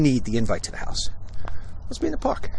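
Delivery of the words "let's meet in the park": mock Baldwin. Imitating Baldwin's cold reply.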